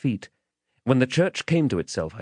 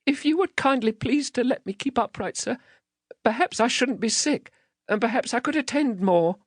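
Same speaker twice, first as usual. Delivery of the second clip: put-on high, giddy child's plea; dreadfully frightened. Giddy child clinging to the man, frightened. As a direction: plead politely in a scared little voice. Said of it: "I was dreadfully frightened, and so giddy".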